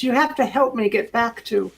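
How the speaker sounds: pleading mermaid. Acted. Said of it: "looks up to Adrian and says".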